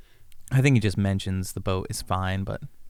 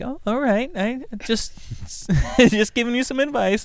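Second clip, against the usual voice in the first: high, meek backing down; sheepish high voice. Acted. Friend giving in to Darwin, high sheepish voice. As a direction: cave in with a small laugh.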